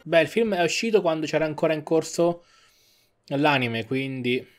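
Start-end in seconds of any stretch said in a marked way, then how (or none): none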